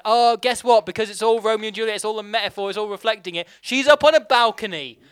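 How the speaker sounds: loud, high-pitched mocking rant; exasperated rant. Real, not acted. Film critic ranting to friends about the balcony metaphor, loud exasperation. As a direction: raise voice and pitch in scornful disbelief.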